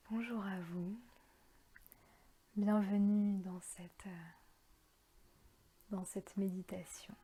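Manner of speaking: half-whispered calm, drawing out each phrase; guided meditation. Acted. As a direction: guide them gently into their breathing, unhurried. Leads a guided breathing meditation.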